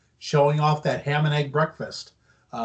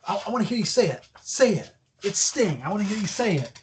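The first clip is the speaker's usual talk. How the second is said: mock eager begging, pitched higher; excited pleading; 5 semitones higher, faster than usual. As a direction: imitate a gushing star pleading. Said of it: "He's all excited".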